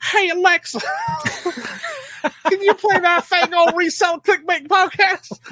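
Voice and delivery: high, put-on Virginia accent of the sister, giggling; imitated Virginia accent. Imitating her sister's Virginia accent for the cohost. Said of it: "she's the one that has the accent".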